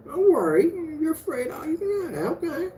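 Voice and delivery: high, whiny fake crying to mock a coward; mock whimpering. Host mocking a scared viewer with fake crying, high and whiny.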